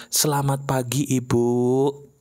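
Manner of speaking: smooth, deferential greeting, a little low; gentle Javanese politeness. Acted. Javanese employee greeting the boss, gentle and polite. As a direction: greet her gently, like a well-mannered subordinate. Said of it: "Dia ngomongnya halus kan".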